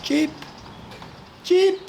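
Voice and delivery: very high thin chirps for help; baby bird chirping. Acted. Fallen chick calling for help from the cold floor, high chirps. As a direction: chirp like a helpless featherless chick. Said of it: "So he started chirping".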